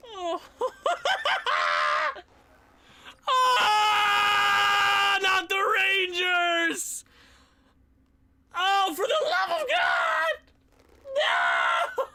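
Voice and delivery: high, loud, slow wail of despair; real comic agony; anguished shout. Real, not acted. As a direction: howl at the cruel playoff draw. Drawing the dreaded Rangers.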